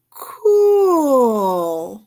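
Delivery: long rising "cool." in admiration; awed drawn-out cool. A narrator's reading. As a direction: stretch "cool." out, amazed. Climax of cool refrain.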